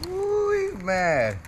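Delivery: excited ooh. Real, not acted. Fish fighting on the line.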